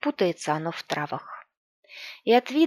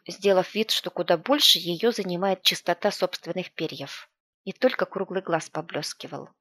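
ordinary delivery, nothing marked

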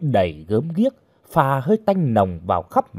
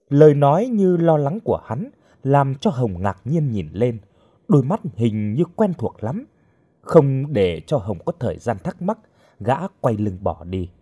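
plain reading voice

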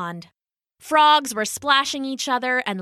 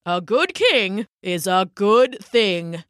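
slow croaking bullfrog; slower than usual. Acted.

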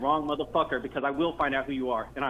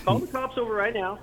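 angry demand, pitched up; real anger; 6 semitones higher than usual. Real, not acted. Angry man insisting the prank caller phone police, worked up. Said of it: "Why are you getting so angry?".

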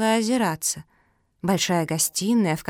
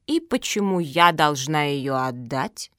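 slow, lower questioning; 4 semitones lower, slower than usual. Acted. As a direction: ask slowly and deliberately. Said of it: "медленно сказала Зоя".

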